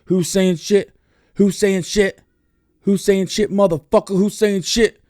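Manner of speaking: high, loud, repeated challenge; mock angry shout. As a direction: bark it out like a guy looking for a fight. Angry dude retold challenging.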